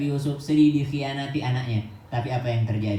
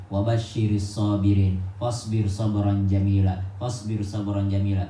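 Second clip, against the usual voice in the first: Quranic recitation; 5 semitones lower than usual. Acted. Quran verse recited in sermon.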